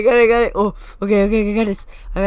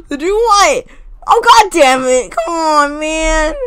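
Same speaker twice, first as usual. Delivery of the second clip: loud, higher, drawn-out groaning complaint; exasperated outburst; 6 semitones higher, 6 dB louder, much slower than usual. Real, not acted. Player let down by the game, exasperated outburst. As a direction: let the frustration pour out loudly.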